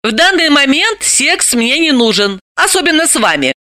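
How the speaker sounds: high-voiced heroine, businesslike; matter-of-fact. A narrator's reading. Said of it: "практично сообщила Далила".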